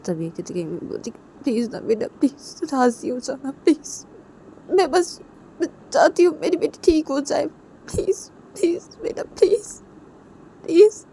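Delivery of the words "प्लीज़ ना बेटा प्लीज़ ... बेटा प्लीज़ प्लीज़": high, tearful mother with whispery pleas; tearful begging. Acted. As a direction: beg through tears, voice thinning to a whisper. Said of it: "आंखों से आंसू को साफ". (आँसुओं भरी गिड़गिड़ाहट)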